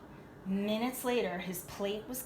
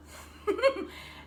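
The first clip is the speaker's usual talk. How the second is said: laugh; 13 semitones higher than usual. Real, not acted. Laughs after joke.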